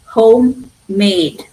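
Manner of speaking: "homemade" slow, MADE longer; stress on made. Shown as a demonstration. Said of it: "I'm stressing".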